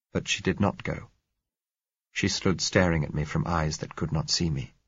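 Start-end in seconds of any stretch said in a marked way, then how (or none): none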